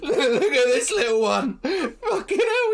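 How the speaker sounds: mock him while cackling drunk; laughing drunk taunt. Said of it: "points down and goes".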